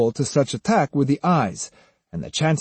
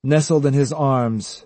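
ordinary delivery

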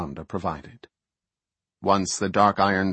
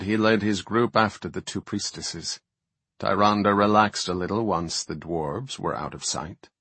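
normal talking voice

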